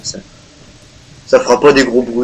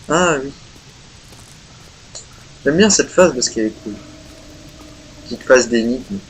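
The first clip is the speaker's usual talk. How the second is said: ordinary talk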